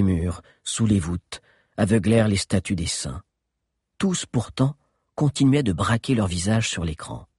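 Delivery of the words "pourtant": usual speaking voice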